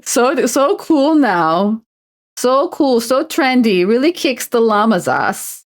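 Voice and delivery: mock gushing. Streamer reminiscing about Winamp skins, mock gushing. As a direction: gush over it like it's the coolest thing.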